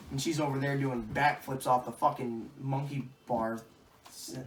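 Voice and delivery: usual speaking voice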